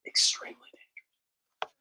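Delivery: a hushed, ominous echo; whispered warning. Shown as a demonstration. Vlogger warns viewers, repeating it in a hushed whisper.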